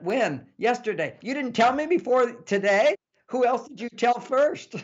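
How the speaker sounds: high-pitched acted indignation; childish mock upset. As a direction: play a wounded partner demanding answers. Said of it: "We become like children in our reactions".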